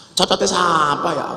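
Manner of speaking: ordinary talk, nothing marked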